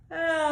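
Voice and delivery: loud high yawning cry; elephant yawn. Shown as a demonstration. Teacher demoing an elephant yawn for kids, loud. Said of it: "he yawns like this".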